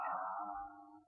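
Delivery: low open aah with tongue out; tongue held down saying aah. Real, not acted. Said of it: "stick your tongue out and say".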